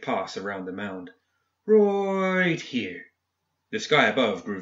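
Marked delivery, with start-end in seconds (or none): 1.7-3.1 high, stretched first word, then falling; drawn-out declaration. A narrator's reading. Man settles on his spot by the corpses, drawn-out declaration. Character marks the chosen spot.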